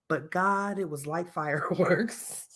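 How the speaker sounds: soft, slow line breaking into a laugh; mock dreamy reprise. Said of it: "She was hella flicking that bean".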